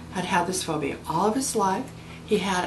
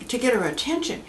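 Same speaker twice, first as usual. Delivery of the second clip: ordinary delivery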